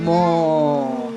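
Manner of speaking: low drawn-out moo like a cow; playful cow moo. Shown as a demonstration. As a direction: moo for the toddler. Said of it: "What does a cow say?".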